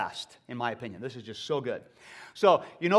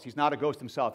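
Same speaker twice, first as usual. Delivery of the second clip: ordinary talk, nothing marked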